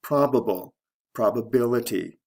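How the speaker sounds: PRObable, then probaBILity; shifting stress. Shown as a demonstration. Teacher showing a pair, stress moving to third syllable in Probability. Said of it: "Stress shift from the first to the third syllable".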